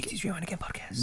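quick whispered title plug; whispering. Acted. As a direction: whisper the show name fast. Whispered podcast title aside.

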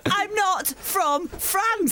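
high, slow, drawn-out denial; exasperated protest. Real, not acted. Teased about being from Manchester in France, exasperated protest. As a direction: protest it word by word, half laughing.

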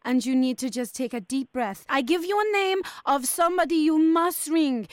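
mock Spanish hotel manager, polite but firm; fake Spanish accent. Prank hotel manager to angry caller, in a fake Spanish accent. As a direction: put on a Spanish accent and keep it courteous.

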